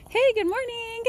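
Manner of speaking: high, sing-out hello; friendly greeting call. Real, not acted. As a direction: call a cheery good morning to passers-by. Calling out to passers-by with dogs.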